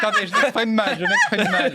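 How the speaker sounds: high, loud protest through laughter; embarrassed, squeaking up with laughter; flustered laughing. Real, not acted. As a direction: laugh helplessly as you excuse your wording. Flustered laughing over his slip.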